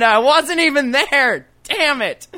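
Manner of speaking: high-pitched comic frustration; exasperated outburst. Real, not acted. Host regrets missing the joke, exasperated. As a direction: wail it like you missed your big moment. Lamenting a missed joke chance.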